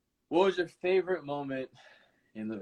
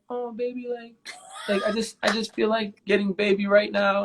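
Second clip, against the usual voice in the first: lightly voiced higher-pitched babyish girlfriend; imitated baby talk; 7 semitones higher than usual. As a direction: mimic her babyish coaxing, pitched up. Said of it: "speaking to her in a baby voice".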